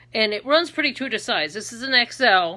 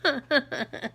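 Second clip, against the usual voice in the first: put-on higher, laughing sitcom accent; Edith Bunker; 5 semitones higher than usual. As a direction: draw the name out in a mock accent. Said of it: "I was always doing that accent".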